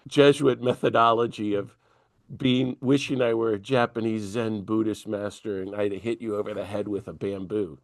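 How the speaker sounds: ordinary talk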